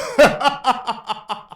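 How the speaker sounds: high, triumphant villain cackle; evil laugh. Acted. Eggman gloating that his plan works, high evil cackle. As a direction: cackle at your own genius.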